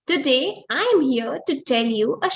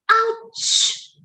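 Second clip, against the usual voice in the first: squeaky ouch; 9 semitones higher than usual. Acted. Animals sit on the mouse.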